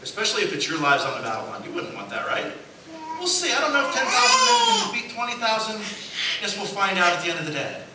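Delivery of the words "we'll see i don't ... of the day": unsure king. Pretend.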